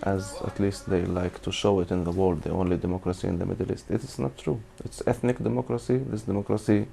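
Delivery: ordinary talk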